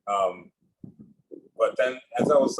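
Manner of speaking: normal talking voice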